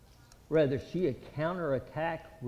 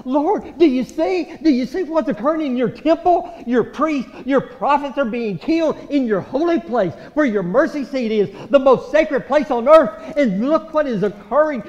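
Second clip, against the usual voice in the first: louder, pleading cry upward; loud anguished plea to God; 8 semitones higher, 12 dB louder than usual. Acted. The city crying out to God, loud and anguished. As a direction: fling the question at heaven.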